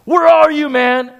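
high, loud call across the house; furious kid shouting for his brother; yelling for Danny. Retold or imitated. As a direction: yell for the brother through the house. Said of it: "and I was screaming".

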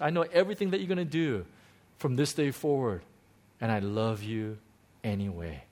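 soft and low, fading to near silence; tender Jesus voice. Retold or imitated. Pastor voicing Jesus to the sinner, soft and tender. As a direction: tell the sinner gently you love him anyway. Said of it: "I said this last week".

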